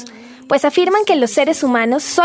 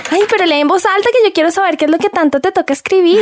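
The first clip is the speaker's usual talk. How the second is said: higher, louder teasing nag; nosy pleading; 6 semitones higher, 4 dB louder than usual. Acted. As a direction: beg to hear it read aloud. Friend nosy about the questionnaire. (curiosa y fisgona, más aguda)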